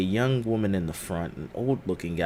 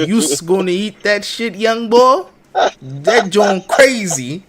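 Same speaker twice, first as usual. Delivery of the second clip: high, loud exaggerated Philly voice; hammed-up Philly impression; mocking Philly accent; 11 semitones higher, 10 dB louder, slower than usual. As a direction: crank up a Philly accent to tease. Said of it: "This is a Philly accent".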